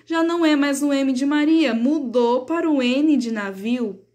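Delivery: usual speaking voice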